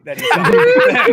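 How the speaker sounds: high laugh over the words; squealing with laughter. Real, not acted. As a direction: crack up while saying it. Joking about the elder god's cousin.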